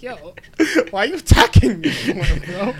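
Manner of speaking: high, loud laughing protest; retold mock protest. Host retells answering the girlfriend, laughing mock protest. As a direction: plead through laughter, voice jumping up. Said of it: "I'm like".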